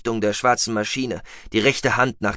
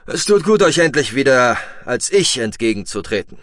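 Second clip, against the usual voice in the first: mildly solemn, projected greeting; almost solemn; 3 semitones higher, 6 dB louder than usual. A narrator's reading. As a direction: declare it with a touch of ceremony. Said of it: "sagte er fast feierlich".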